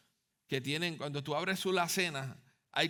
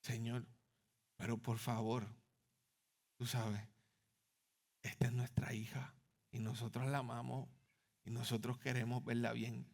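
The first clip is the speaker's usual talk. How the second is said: soft, broken whisper of surrender; tearful hushed prayer; 4 semitones lower, 5 dB quieter than usual. Retold or imitated. Father crying in the bathroom to God, soft tearful whisper. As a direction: barely whisper it, as if weeping. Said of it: "empecé a llorar y a llorar".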